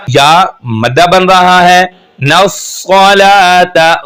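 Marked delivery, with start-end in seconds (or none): 2.2-4.0 नस्सलाता with a thick, heavy स and a firm press, drawn out; heavy swad. Shown as a demonstration. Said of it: "स्वाद को मोटा करके पढ़ेंगे".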